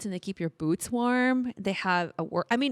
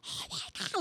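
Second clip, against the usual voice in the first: stunned whisper; 9 dB quieter, faster than usual. Retold or imitated. Stunned whisper on seeing Issa Rae.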